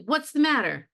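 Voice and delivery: alarmed. Acted. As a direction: jump in alarmed. Willie's line read aloud.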